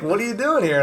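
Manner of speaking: mock the chef catching him off guard; chef's surprised question. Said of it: "he was like".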